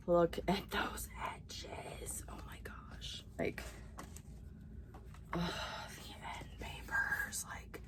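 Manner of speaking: breathless whisper over the pretty edges; excited whisper. Real, not acted. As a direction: hush it like the book is too beautiful to speak aloud. Gushing over book edges.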